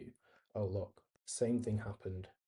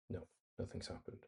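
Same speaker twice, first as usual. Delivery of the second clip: deflated near-whisper; 9 dB quieter than usual. Real, not acted. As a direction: drop to a breathy, disappointed murmur almost under the breath. Refresh fails, says it twice.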